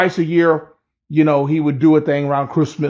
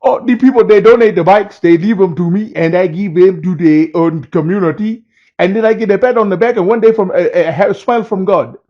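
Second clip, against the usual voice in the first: higher, louder put-on African accent, innocent and sweet; 3 semitones higher, 4 dB louder than usual. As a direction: play the innocent donor in an imitated African accent. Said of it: "he had a little African accent".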